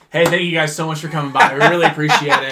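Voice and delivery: loud, over-polite send-off; mock customer-service politeness. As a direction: gush thanks at unwelcome customers, loudly.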